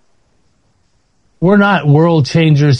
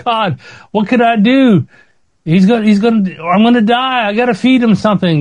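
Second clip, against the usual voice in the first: high, desperate pleading, quickening at the end; a retold frantic plea, voice pitched up; panicked cry to God; 9 semitones higher than usual. A frightened man crying out to God, begging what to do, in high panic. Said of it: "I cried out to God".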